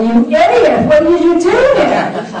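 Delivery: hammed-up yell at a bad driver; mock yell. Imagined GPS scolding the driver for a wrong turn, mock yell. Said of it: "She doesn't a-, yell".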